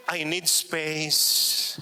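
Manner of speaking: very slow, drawn-out "I need space"; mock teen line. Mother quoting her teenage son, slow and drawn out. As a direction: stretch it out like a sulky teen. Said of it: "Palagi niyang sinasabi".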